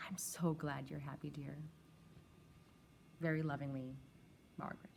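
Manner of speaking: soft loving whisper; whispered closing to a dear one; tender whisper. Acted. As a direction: breathe it like a fond goodbye. Tender sign-off of a letter.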